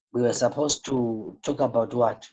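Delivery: usual speaking voice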